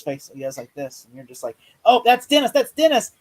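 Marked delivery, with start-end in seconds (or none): 1.9-3.1 high, loud burst of realization; excited recognition. Acted. Film fan acting out recognizing the character, excited. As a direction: exclaim it like a struck viewer.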